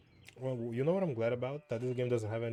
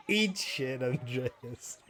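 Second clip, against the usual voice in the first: laughing; 2 semitones higher, 5 dB louder, much slower than usual. Acted. Laughing taunt at character.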